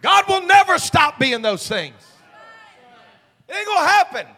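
loud, high-pitched sermon shout; full-force preaching. Real, not acted. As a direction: preach it out at the top of your voice.

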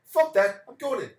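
high, loud mock shout; cheerful Irishman shouting he's skipping work for a pint; loud refusal. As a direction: blast it out, skipping work gladly.

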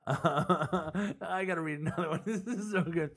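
laughing hard. Real, not acted. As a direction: talk through helpless giggles. Cracking up at the tweets.

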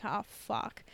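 ordinary delivery

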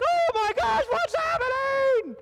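very high, hammed-up frantic cry; mock panic. A worried believer imitated in a mock panicked squeal. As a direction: squeal it like someone freaking out. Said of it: "you're like".

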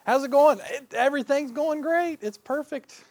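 forced happy front; fake cheerful. Shown as a demonstration. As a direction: pretend all is great. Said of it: "the fake front".

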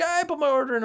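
a light high customer impression; mock customer. Customer imitated.

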